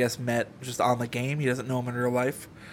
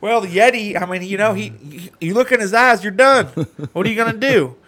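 raised, loud, hammed-up excuse; mock defense; 7 semitones higher, 8 dB louder than usual. Culprit defending himself in court, loud mock-innocent protest. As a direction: plead helplessness high and loud.

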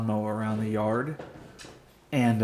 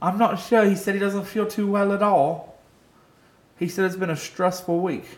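fake European accent; 6 semitones higher than usual. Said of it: "terrible, like, South African accent".

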